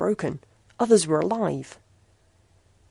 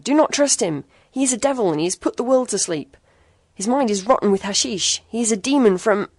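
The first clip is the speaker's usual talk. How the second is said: high, loud, frantic warning; strained screaming; 5 semitones higher, 6 dB louder than usual. A narrator's reading. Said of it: "harsh and strained with excitement".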